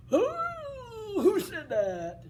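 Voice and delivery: a giant woken and suspicious. Acted. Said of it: "And the giant goes".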